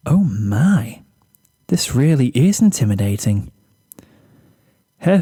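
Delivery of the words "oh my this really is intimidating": timid, breathy-free shy pony voice; a lightly voiced timid mare; shy Fluttershy. Shy pony admires her jacket.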